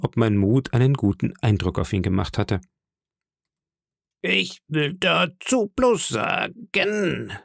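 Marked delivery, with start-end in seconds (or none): 4.2-7.3 put-on higher, drawn-out old sailor voice; slow, high-pitched call of an old seaman. Old sailor objects before the pirates, calling out slowly. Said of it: "rief der alte Matrose".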